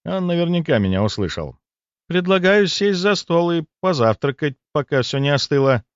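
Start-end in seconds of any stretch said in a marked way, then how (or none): none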